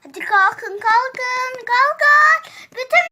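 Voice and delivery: building from loud call to high shout; wake-up shouting, rising each time; yelling to wake up. Acted. Mother waking children.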